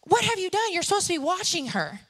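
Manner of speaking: imitating a mom scolding, pitched high; high, outraged retelling of mom's reproach; alarmed mother. Mother confronts dad over crying child, alarmed. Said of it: "my mother comes out and goes".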